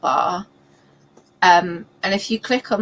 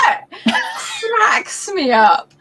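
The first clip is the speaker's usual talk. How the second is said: laughing high and slow; 12 semitones higher, much slower than usual. Real, not acted. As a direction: crack up while saying it. Laughing about sweary readback.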